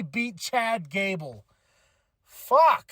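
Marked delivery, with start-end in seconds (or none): 2.2-2.9 sudden loud, high curse of frustration; real exasperated outburst; frustrated curse. Real, not acted. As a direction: blurt it out, stung by the result. Frustrated outburst at match result.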